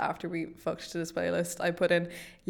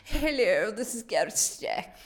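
a slightly higher put-on posh English accent; 3 semitones higher, slower than usual. Doing a posh English accent to tease the co-host. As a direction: answer the phone in an overdone posh English accent. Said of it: "you always sound like the Queen's maid doing an impression".

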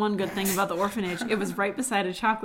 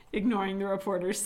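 usual speaking voice

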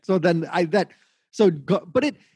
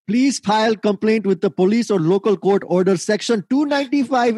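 put-on reading a tweet in an Indian accent; a lightly done Indian accent, a bit higher; 5 semitones higher than usual. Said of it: "you have to read any Indian tweet in an Indian accent".

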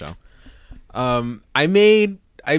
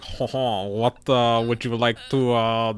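lightly put-on French accent; hint of a cheesy French accent; fake French accent; 4 semitones lower than usual. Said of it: "they all have these fake French accents".

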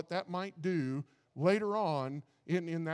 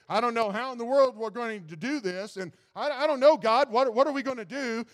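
rising, louder, pleading cry of a worried worker; a mock-desperate worker crying out, louder and higher; desperate plea to God; 5 semitones higher, 7 dB louder than usual. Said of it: "you're thinking".

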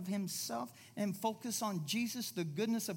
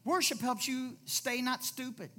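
ordinary talk, nothing marked

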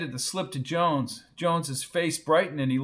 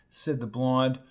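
plain reading voice

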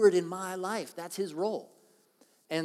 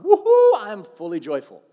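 whooping high and loud, hammed-up; a big mock whoop of joy; mock cheer; 5 semitones higher, 18 dB louder than usual. Preacher imitating someone whooping over a payday.

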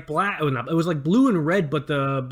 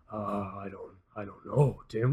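meek mumble; 8 semitones lower, 9 dB quieter, slower than usual. Retold or imitated. Terrified coworker voiced.

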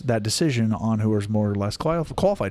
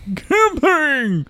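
mocking echo; 5 dB louder, much slower than usual.

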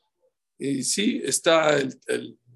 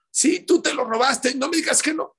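high, loud furious accusation; 9 semitones higher, 5 dB louder than usual. Retold or imitated. Angry woman accusing the taxi driver over the phone, shouting. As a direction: shout him down, sure he stole it.

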